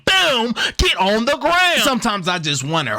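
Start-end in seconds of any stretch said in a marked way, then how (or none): 0.0-1.8 hyped up. Real, not acted. Gamer hyping his receiver's catch, excited call. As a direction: yell it like you just scored.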